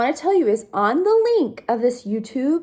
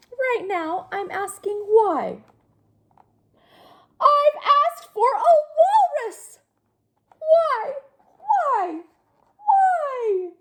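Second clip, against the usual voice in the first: high, whining pigeon voice; whiny pigeon; 14 semitones higher, slower than usual. Acted. Pigeon whines why at the kids, very high. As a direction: plead why over and over.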